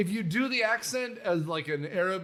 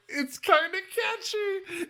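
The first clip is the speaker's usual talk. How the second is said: Cogsworth imitation; 11 semitones higher, slower than usual. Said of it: "Cogsworth is kind of like".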